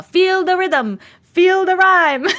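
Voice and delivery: high, loud mock-Jamaican chant; bad Jamaican accent. Streamer quoting Cool Runnings to chat, bad Jamaican accent. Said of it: "only thing I can do that's partly Jamaican".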